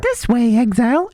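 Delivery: high-pitched mimicked NPC call; high mock character voice; imitated game NPC. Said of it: "does she say".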